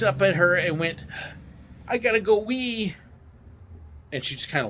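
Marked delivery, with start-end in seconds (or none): 1.9-2.9 put-on childlike plea. Driver pranking his boss at the load station, childlike plea. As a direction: play up a pleading need, high-pitched.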